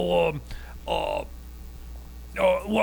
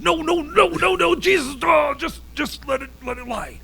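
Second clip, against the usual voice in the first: agitated; 9 semitones higher than usual. Acted. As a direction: cut him off in a panic, then trail off. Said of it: "you seem agitated".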